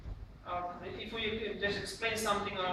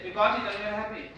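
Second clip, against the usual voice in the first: lifeless delivery; 8 dB louder, faster than usual. Shown as a demonstration. Trainer shows presenting without facial expression, rushed and lifeless. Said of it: "If the present is lifeless".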